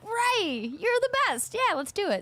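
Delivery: excited reply. Retold or imitated. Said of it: "And I was like".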